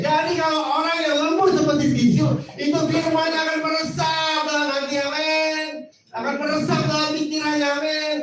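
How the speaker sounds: high, ringing preacher's call to the congregation; fervent preaching. Real, not acted. Pastor preaching fervently to the congregation. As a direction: lift it up and drive it home with amen.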